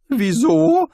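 high, loud startled yelp. A narrator's reading. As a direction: squeak it out in alarm. Rincewind startled by stranger.

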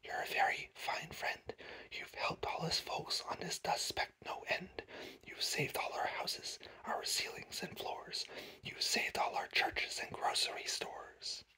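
barely audible whisper throughout; very soft, airy whispering; faint tiny voice. A narrator's reading. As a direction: whisper gratefully from a tiny world. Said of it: "The voice was so faint he could just barely hear it".

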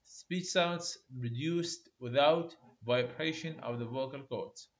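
very slow, word by word for note-taking; slow dictation. Shown as a demonstration. Teacher to students copying notes, slow dictation. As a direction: dictate it slowly so students can write. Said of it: "definition dictated".

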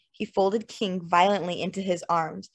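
plain reading voice

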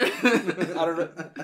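slow Southern drawl, laughing; southern accent. Pretend. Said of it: "I didn't mean to do a southern accent".

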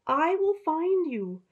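shepherd calling to the lost lamb. Acted. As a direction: call out a promise to the lamb. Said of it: "the shepherd called out".

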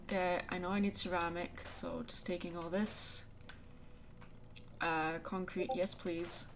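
normal talking voice

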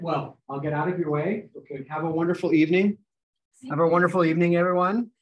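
normal talking voice

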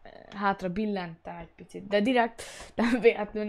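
ordinary talk, nothing marked

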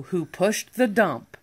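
usual speaking voice

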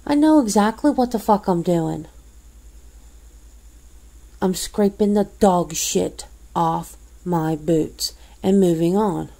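normal talking voice

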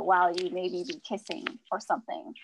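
ordinary talk, nothing marked

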